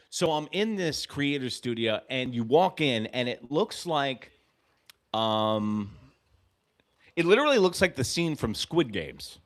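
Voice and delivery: usual speaking voice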